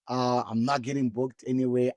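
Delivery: usual speaking voice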